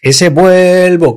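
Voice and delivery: slow, high, stretched vuelvo; drawn-out vuelvo. Shown as a demonstration. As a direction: drag the word vuelvo out. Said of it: "que dura media hora".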